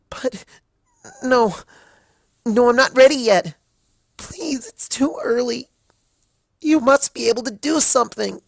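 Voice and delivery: very high, loud crying plea; tearful pleading. A narrator's reading. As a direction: sob and plead against it. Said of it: "she pleaded".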